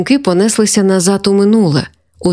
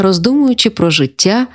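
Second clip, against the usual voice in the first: ordinary delivery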